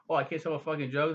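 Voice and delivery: quick, mildly aggravated retort; angry horse. Retold or imitated. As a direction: imitate an exasperated horse. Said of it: "the horse is so angry".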